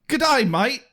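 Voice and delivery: hammed-up Australian accent; mock Australian accent. Cohost tries again in a mock Australian accent. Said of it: "That's better".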